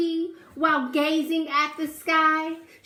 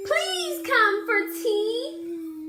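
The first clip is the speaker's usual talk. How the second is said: high, slow pleading call; pleading cry; 7 semitones higher, slower than usual. A narrator's reading. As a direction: call out sweetly to the bees. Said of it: "Miss Spider cried".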